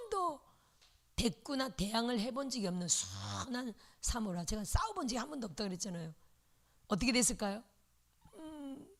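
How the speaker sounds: ordinary delivery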